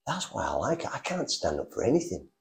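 a quiet, ashamed admission; hushed inner thought. Retold or imitated.

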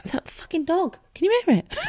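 sudden high-pitched exclamation at the barking dog; startled outburst. Real, not acted. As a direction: caught off guard, voice jumps up. Dog barks during live recording.

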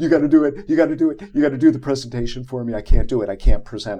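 loud, frantic begging, pitch easing down as it runs on; panicked pleading. Retold or imitated. Retelling a plea to coworker to take over the presentation, panicked. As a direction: plead desperately at full volume, phrases tumbling out.

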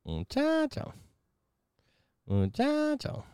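silly falsetto call; high playful call. Pretend. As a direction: croon it way up high.

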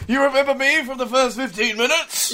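lightly voiced old man, laughing.

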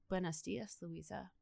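an eerie voice drifting through rubble; mystical. Retold or imitated. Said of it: "there's, like, mystical music playing".

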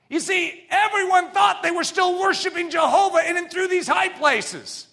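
full-throated sermon climax; preaching at full force. Acted. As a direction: drive the point home from the pulpit.

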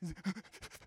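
imitated crying wheeze; mock sobbing gasps. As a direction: gasp like you cried yourself into asthma. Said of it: "cry so much you get asthma".

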